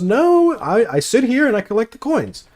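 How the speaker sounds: high, innocent mock-kid voice; mock naive kid. Imitating the kid who collects coins, high and naive. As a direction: squeak it out like a clueless child. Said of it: "And he goes".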